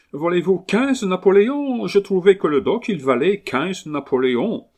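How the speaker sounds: high, loud Englishman's French; English accent. Acted. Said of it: "l'accent anglais".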